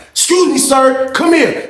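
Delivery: high, slow stern call; booth lady. Retold or imitated. Booth lady ordering the inmate over, high stern call. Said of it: "was like".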